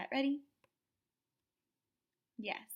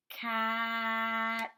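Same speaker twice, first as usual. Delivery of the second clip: "Cat" with the vowel held long on a level note; quick c, drawn-out middle, quick t in "Cat"; sounded out slowly. Shown as a demonstration. Teacher repeating the model for the student, "Cat" sounded out slowly. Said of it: "Listen again".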